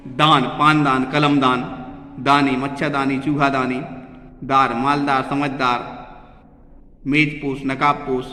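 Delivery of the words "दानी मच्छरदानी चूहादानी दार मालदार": normal talking voice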